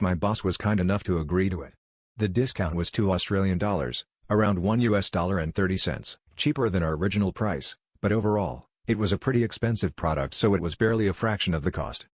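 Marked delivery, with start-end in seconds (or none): none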